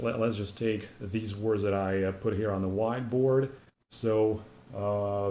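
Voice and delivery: ordinary delivery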